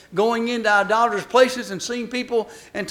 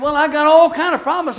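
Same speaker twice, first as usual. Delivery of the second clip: louder, higher whiny complaint; a hint of a put-upon driver; hapless stranded man; 5 semitones higher, 7 dB louder than usual. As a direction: pile on the troubles.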